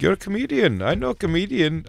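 imitating an Indian cabbie, high-pitched; Indian accent. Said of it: "Indian guy".

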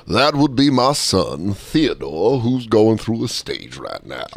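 low, slow gentleman voice; deep, weary patriarch; embarrassed plantation dad. Acted. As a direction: explain it with measured dignity. Acted father explaining to business guest.

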